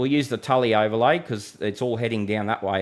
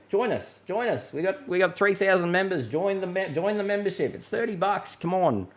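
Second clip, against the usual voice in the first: higher, coaxing plea to sign up; pleading sales pitch; 6 semitones higher than usual. Real, not acted. Forecaster urging viewers to buy membership, coaxing and higher. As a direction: nudge them to join, half begging.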